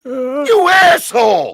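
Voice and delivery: high, drawn-out yell of insult; mock outraged shout. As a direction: yell it slowly in comic outrage. Retelling angry reply to bad news.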